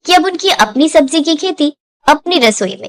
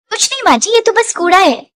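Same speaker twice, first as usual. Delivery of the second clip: put-on nervous young daughter-in-law; 7 semitones higher than usual. Daughter-in-law lying to mother-in-law about the hidden box, nervously evasive. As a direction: brush it off like a caught bahu.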